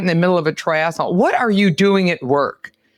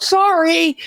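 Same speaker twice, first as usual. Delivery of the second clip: yelled apology; 12 semitones higher than usual. Retold or imitated. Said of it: "I would hear a woman yell out".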